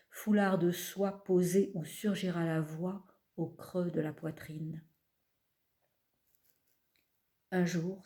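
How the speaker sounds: measured, unhurried recital with long pauses; poetry reading. Acted. Poet reading from her collection to an audience, measured recital. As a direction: let each image settle before the next. Reads poems aloud.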